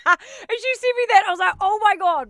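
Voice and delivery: loud, high burst straight out of laughter; amused outburst. Real, not acted. Host reacts to Nina's voice message, amused outburst. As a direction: exclaim it at high pitch, still tickled by the rant.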